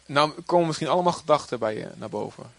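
ordinary talk

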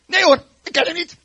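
high, loud, hasty-sounding denial. Acted. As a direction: shout the accuser away. Peter loudly denying Jesus.

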